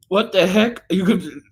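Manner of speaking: high, startled what the heck; incredulous. Real, not acted. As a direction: caught off guard, pitch jumps up. Reacting to a chat message.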